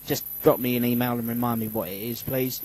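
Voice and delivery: normal talking voice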